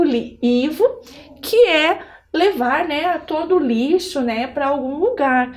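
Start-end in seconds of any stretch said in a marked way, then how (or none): none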